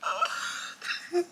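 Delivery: real tears breaking through, voice high and strained; crying. Real, not acted. Mother recalling her daughter's birth, crying openly. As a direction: she tries to speak but sobs take over. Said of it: "didn't wanna cry".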